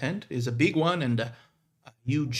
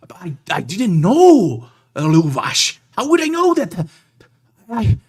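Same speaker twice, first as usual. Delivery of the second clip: loud, high, panicky stammer; flustered excuse; 7 semitones higher, 13 dB louder than usual. Acted. Young man caught out by his elder, loud and panicked. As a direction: plead your ignorance in a rising panic. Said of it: "And he goes".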